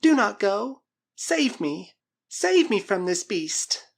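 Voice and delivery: high, loud, drawn-out plea; desperate cry. A narrator's reading. Girl begs Karl to save her, high desperate cry. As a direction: beg for rescue, voice pitched high. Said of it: "she cried".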